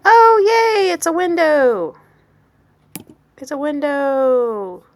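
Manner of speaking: high, loud happy squeal; excited. Real, not acted. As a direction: cheer at the result. Delight at result.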